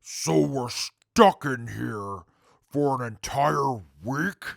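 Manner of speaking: usual speaking voice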